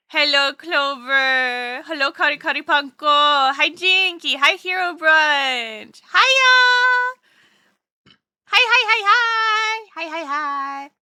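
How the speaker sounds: high, loud, drawn-out hellos; gleeful calling-out to friends; excited greeting. Real, not acted. Greeting arriving viewers one by one.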